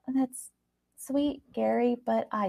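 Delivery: soft, placating; gentle. Acted. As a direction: let him down gently. Eleanor softly placating.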